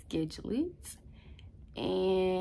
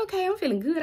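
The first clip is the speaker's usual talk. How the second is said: excited self-pep talk; 10 semitones higher, 6 dB louder, faster than usual. Retold or imitated. Pumped inner voice before score.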